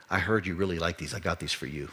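put-on older lady giving the boy a gift softly; tender. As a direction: hand it over kindly, almost hushed. Old lady gives kind gift.

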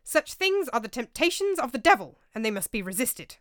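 loud, pitch rising high on the devil; indignant protest. A narrator's reading. Roger lecturing the table, loud and indignant. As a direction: hammer home his righteousness.

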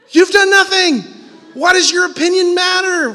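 loud, high shout at the critics; mock shouting back. Preacher imagines shouting at couch critics, loud mock yell. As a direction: yell it right back at them.

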